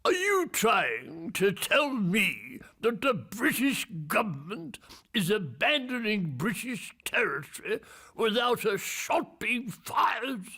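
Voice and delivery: high-pitched, incredulous voice of authority; authoritative boss. Retold or imitated. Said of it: "one of those very authoritative voices".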